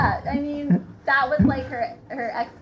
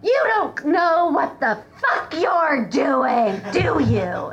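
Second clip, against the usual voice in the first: louder, slow, laughing mock rant; angry customer; 5 semitones higher, 7 dB louder, slower than usual. Angry customer berating the server over a salad, mock rant. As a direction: throw the insult at the server. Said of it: "as he tossed it with his bare hands".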